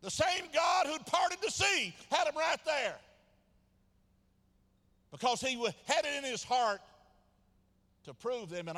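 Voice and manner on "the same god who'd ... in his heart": high, loud pulpit shouting; full-force preaching. Real, not acted. Preacher rebuking those who blame the devil, full-force preaching.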